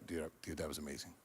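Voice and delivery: hushed amazement. Retold or imitated. Imitating the angry man after gratitude list, hushed amazement. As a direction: drop to a stunned murmur. Retelling man's awed reaction afterwards.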